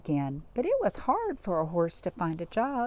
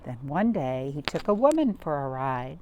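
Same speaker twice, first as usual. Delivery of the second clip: ordinary delivery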